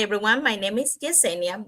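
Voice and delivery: normal talking voice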